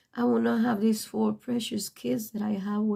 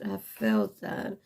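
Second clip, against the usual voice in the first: low, drawn-out playful repeat of "I felt that"; mock-deep echo of own words; 12 semitones lower, 3 dB quieter, slower than usual. Shown as a demonstration. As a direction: drop the voice way down and slow for "I felt that". Repeats line in deeper voice.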